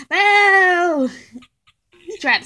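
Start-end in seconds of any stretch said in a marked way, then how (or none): 0.1-1.1 loud mock "Boo!" to spook; mock scare. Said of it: "he's like".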